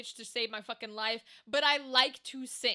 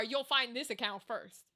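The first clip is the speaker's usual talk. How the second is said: normal talking voice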